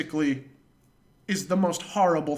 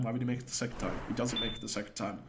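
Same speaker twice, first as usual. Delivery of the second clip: ordinary delivery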